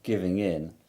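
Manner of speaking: usual speaking voice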